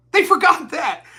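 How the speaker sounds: usual speaking voice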